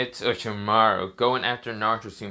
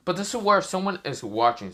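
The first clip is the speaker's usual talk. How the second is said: ordinary delivery, nothing marked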